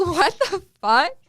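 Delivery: slow incredulous outcry; shocked disbelief. Retold or imitated. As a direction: disbelief at being kicked off the plane. Shocked when everyone deplanes.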